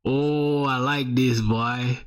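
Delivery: normal talking voice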